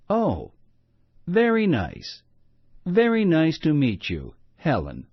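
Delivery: put-on a smiling mother, mild accent; warm best English. Mother warmly greets Helen in lightly accented English. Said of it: "said Mommy".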